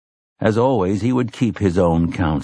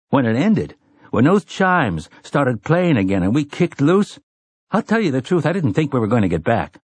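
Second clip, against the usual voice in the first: lightly voiced young man, higher pitch; earnest Eddie; 6 semitones higher than usual. Man admitting he feared never getting back, lightly voiced and earnest. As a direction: confess it earnestly in a slightly raised character voice.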